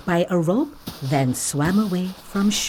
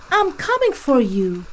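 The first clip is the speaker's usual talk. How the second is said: a raised call across the water; 7 semitones higher, 4 dB louder than usual. Acted. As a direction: call out to reassure her. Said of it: "Kim called out".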